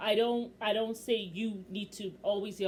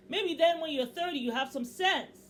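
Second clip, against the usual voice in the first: heated scolding, higher and louder; fed-up rant, pitched up and forceful; worked up; 6 semitones higher, 6 dB louder than usual. Real, not acted. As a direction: let the exasperation build into a lecture. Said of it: "Okay, let me calm down".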